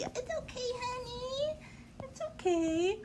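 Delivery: high, soft reassurance for a frightened puppy; calm her down sweetly; soothing pet talk. Real, not acted. Soothing dog scared of thunder.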